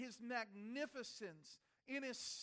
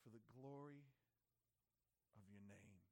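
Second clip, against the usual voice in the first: quiet resolve; 8 semitones lower, 14 dB quieter than usual. Retold or imitated. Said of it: "the Son said to the Father".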